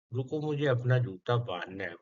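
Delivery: normal talking voice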